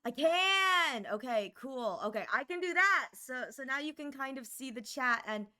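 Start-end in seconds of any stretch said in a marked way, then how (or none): 0.1-1.1 loud high delight; excited. Real, not acted. Streamer realizing she can move head, loud high triumph. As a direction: cry out in triumph.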